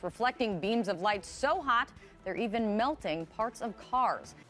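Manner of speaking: ordinary talk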